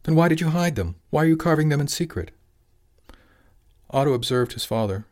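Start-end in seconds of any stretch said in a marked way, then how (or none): 0.0-2.3 high, loud interrogation; angry demand. A narrator's reading. Father demanding why son hid carvings, angry. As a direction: fire both questions off indignantly.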